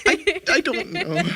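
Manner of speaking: high, slow laugh through the words; laughing helplessly. Real, not acted. Answering friend's teasing question, laughing helplessly. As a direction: laugh at your own gullibility. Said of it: "How could you not?".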